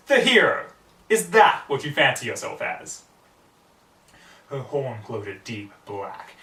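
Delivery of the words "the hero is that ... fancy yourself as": loud, high, scornful villain taunt; mocking villain. A narrator's reading. Nightmare Moon jeering at Rarity, loud and scornful. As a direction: sneer it out like a gloating queen.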